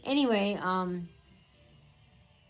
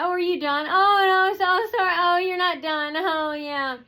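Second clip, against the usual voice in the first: much higher and louder fake-sorry mockery; mocking taunt; 8 semitones higher, 5 dB louder than usual. Staff member mocking the patient who isn't done, high sarcastic voice. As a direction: pour on sarcastic fake apology.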